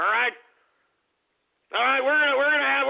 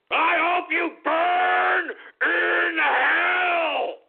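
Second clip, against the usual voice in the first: outraged crying yell; 8 semitones higher, slower than usual. Acted. Host scolding the troll over his family, shouting and sobbing. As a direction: let the fury spill over into a sob.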